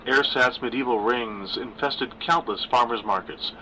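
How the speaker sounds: usual speaking voice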